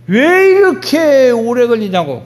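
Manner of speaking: high, loud public grumble; drawn-out protest at the delay, imitating everyone; impatient complaint. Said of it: "국민들이 다 그래요".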